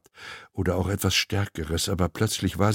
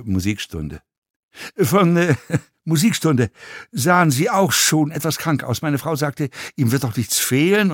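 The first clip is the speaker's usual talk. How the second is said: lightly voiced talkative passenger, livelier than the narration; talkative fellow passenger; 7 semitones higher, 4 dB louder than usual. A narrator's reading. Passenger tells an actor he looked ill, lightly voiced chattiness. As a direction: play a hesitant, nosy fan with little stumbles.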